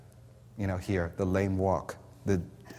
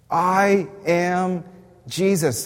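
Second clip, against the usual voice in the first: louder, higher proclamation; emphatic repeat; 7 semitones higher, 6 dB louder, much slower than usual. Acted. Preacher repeating God's answer to the church, loud and emphatic. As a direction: drive it home to the crowd.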